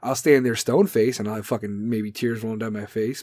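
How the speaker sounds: ordinary delivery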